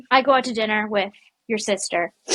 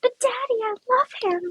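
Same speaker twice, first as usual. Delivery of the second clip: high mock plea to father; Ariel pleading; 12 semitones higher than usual. Daughter begging her father, high mock plea. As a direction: whine it like a lovesick princess. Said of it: "Little Mermaid quote again".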